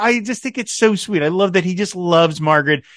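ordinary talk, nothing marked

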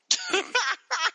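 high-pitched burst of laughter. Real, not acted. Reacts to absurd letter.

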